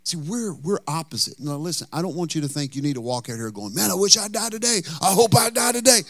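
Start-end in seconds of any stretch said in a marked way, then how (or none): 3.7-6.0 high, sing-song mock eagerness; mock death wish. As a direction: mimic an overeager churchgoer, pitched way up. Mimicking what listeners should not say.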